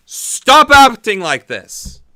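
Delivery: high, slow, loud plea; pleading shout. Acted. Vlogger yelling a plea at Russia, loud and slow. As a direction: cry out at Russia, slowly.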